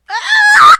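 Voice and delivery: high, loud shriek; startled scream. Real, not acted. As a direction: scream out suddenly at the game. Scream during game.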